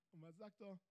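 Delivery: ordinary talk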